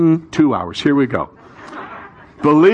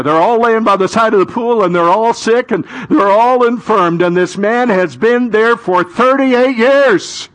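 high, loud, climbing pulpit delivery; impassioned storytelling from the pulpit, pitch soaring; full-force preaching; 9 semitones higher, 5 dB louder than usual. Real, not acted. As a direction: stretch the key words, building to a cry. Preacher dramatizes the Bible story.

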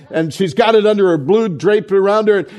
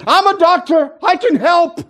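put-on high, louder proud kid voice; eager little boy; 11 semitones higher, 3 dB louder than usual. Little boy doctor volunteering from the front row, high eager voice. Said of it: "and he goes".

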